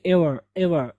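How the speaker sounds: "ever" starting with a slight ee; teacher showing the light ee in "ever". Shown as a demonstration. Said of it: "हल्की सी आप ई की आवाज़ निकालेंगे".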